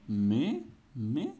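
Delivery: loud, incredulous double question; surprised. A narrator's reading. Rabbit learning he is to join in, astonished. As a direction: swoop up sharply on each word. Rabbit hears he must discover it.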